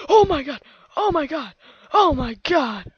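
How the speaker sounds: loud, repeated alarmed cries; loud, frantic outburst; panicked exclaiming. Real, not acted. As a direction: exclaim in a burst of game panic. Panics falling off a cliff in game.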